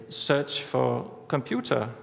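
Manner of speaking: slow, deliberate voice command; slow spoken command. Shown as a demonstration. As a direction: spell out each word for the phone. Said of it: "if you're very articulate".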